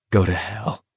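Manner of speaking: dead, washed-out. A narrator's reading. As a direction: say it like nothing's left. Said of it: "in a dead, washed-out voice".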